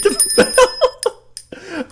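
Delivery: high, slow, giggling; laughing. Real, not acted. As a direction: stretch it out while giggling. Laughing during outro.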